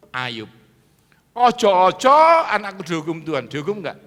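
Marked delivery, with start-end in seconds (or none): 1.3-3.5 loud, high mock pleading; acted fearful prayer. Preacher acts Job fearing God's punishment on his child, hammed-up plea. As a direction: wail the first word as an anxious plea. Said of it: "Ayub itu tiap hari berdoa".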